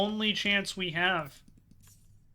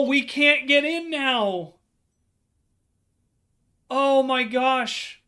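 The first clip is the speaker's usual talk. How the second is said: slow dismay; 6 semitones higher, 5 dB louder, slower than usual. Real, not acted. Locked out of the rooms.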